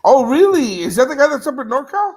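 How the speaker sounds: high, loud, surprised questioning; real surprise. Real, not acted. Just learns who Max is.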